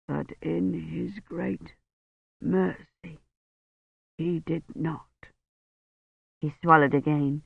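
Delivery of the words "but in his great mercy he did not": slow, much softer, thick-tongued words; blurred dying voice. A narrator's reading. Dying Roche speaks to Kivrin, slow, soft and blurred. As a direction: speak through a swollen tongue.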